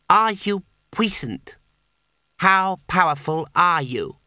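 high, slow, pressing repeat of "are you puissant"; insistent repeat. Acted. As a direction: lean on the question again, firmer. Boy repeats his demand.

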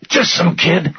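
high, strained victim's voice; pained answer. A narrator's reading. Rudder questioned about the boy, pained. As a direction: answer high, under duress. Tortured man answering his interrogator.

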